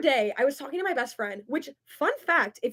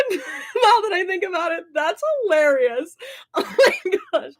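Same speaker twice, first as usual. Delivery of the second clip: laughing; 6 semitones higher, 3 dB louder than usual. Real, not acted. Laughing about her Spanish grade.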